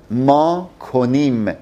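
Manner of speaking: normal talking voice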